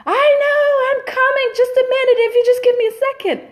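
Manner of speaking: high, squeaky rushed mock voice; a frazzled working mother answers while busy, squeaky and high; imitating a flustered mom. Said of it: "And then she goes".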